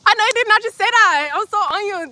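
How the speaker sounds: high, loud mock outrage at a comment; outraged disbelief. Real, not acted. Streamer scandalised by a viewer comment, shrieking.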